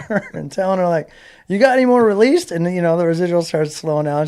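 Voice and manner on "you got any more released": high, loud mock-eager question; eager pestering. Racer keeps phoning agent for money, mock-eager. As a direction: push the pitch up, comically hopeful.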